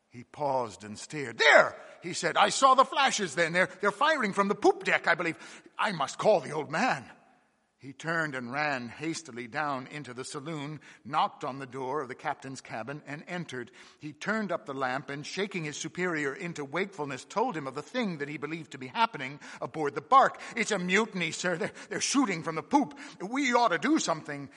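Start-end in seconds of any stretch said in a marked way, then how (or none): 1.4-7.1 high, loud hurried alarm; alarmed excitement. Acted. Mate sees flashes, alarmed. 20.6-24.5 breathless and urgent, higher and louder; young mate waking the captain, breathless. A narrator's reading. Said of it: "said many things breathlessly".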